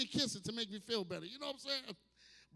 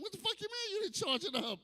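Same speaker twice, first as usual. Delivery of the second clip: high-pitched indignant rant, slowing at the end; mock outrage; 10 semitones higher than usual. Man scolding a dead vibrator, mock outrage. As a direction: act scandalized that the toy isn't charged. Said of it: "I be like".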